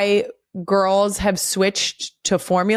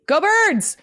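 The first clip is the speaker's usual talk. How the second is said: cheer; 11 semitones higher than usual. Shown as a demonstration. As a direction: cheer it like a fan in the stands. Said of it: "Let's do eight".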